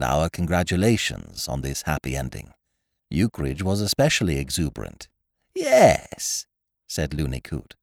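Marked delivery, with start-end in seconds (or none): 5.5-6.4 high, excited yes; bright-eyed, high-voiced pride; delighted confirmation. A narrator's reading. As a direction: confirm it with high-pitched glee. Looney confirms his happy win.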